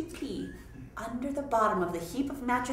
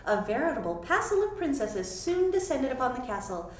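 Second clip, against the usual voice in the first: ordinary delivery, nothing marked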